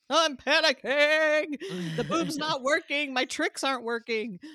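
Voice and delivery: high, loud, stretched-out mock wail; mock panic. Mom retelling a sleepless night with her baby, mock-panicked.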